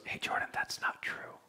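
soft unvoiced whisper of a small voice; whispered inner voice. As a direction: whisper it like a voice in your head. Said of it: "this little voice speaks up".

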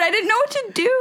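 high, flustered confession; mock panic. Friend recalling handing over the phone, flustered and high. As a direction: play up the panic, pitched high. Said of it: "I got scared".

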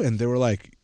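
ordinary talk, nothing marked

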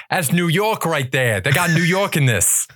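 put-on kid's New York heckle, pitched high; New York accent. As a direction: imitate an excited New York kid. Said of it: "a very genuine New York accent".

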